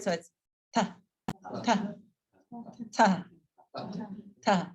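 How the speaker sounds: ta with the tongue on the teeth; dental ta. Shown as a demonstration. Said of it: "So we wanna come forward".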